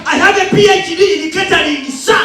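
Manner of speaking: stiff recruit snapping answers upward; eager army recruit. Acted. Recruit answering drill sergeant.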